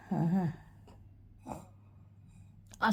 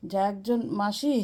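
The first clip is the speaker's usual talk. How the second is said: ordinary talk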